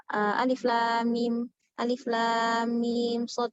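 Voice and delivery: alif lam mim sod spelled out slowly; slow recitation example. Shown as a demonstration. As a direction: recite each letter name, stretched. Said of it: "Jadi alif tetap dibaca alif". (lafalkan alif lam mim sod perlahan)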